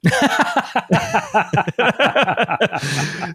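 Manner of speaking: high burst of real laughter; breaking into giggles; laughing at the word butthole. Real, not acted. Laughs after saying butthole.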